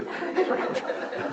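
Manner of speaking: a quiet, airy chuckle; soft breathy laugh. Real, not acted. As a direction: let a breathy laugh slip out. Interrupted mid-sermon, laughs.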